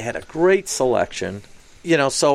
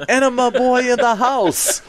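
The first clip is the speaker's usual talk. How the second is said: mock hype-man shout of a nickname; excited announcement; 12 semitones higher, 4 dB louder than usual. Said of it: "he was all excited".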